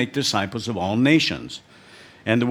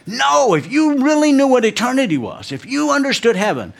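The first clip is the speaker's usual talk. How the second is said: put-on much higher, louder, worked-up old mother's voice; mom scolding; 14 semitones higher, 6 dB louder than usual. Aged mom rebuking her son for planning to mourn, high and loud. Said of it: "She got really mad at me".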